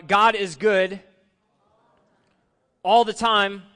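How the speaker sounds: loud, ringing call for a response; call to congregation. Shown as a demonstration. Pastor calling out to the congregation, loud and ringing. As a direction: proclaim it out to the congregation.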